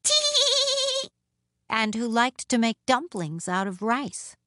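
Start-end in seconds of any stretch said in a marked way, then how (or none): 0.0-1.1 very high, drawn-out "tee-hee" giggle; funny little woman's giggle; high tittering laugh. A narrator's reading. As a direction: titter away on a high note. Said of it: "laughed the funny little woman".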